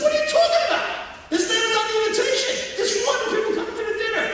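high, loud and fast indignant cry; indignant outburst. Retold or imitated. Assistant principal scolding the wife on phone, high and loud. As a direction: play the outraged school official.

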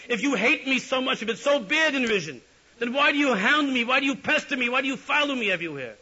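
high, loud, exasperated yell. Retold or imitated. The Rebbe yells at Yitzchak, exasperated and loud. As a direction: shout him down in exasperation. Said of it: "begins to yell at Yitzchak".

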